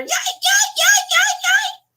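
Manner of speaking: very high yipping yays; yipping dog. Retold or imitated. Imitating Pepper's yelps as the dogs ganged up. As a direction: yip like a little dog crying.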